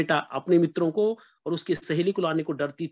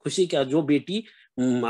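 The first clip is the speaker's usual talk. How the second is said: normal talking voice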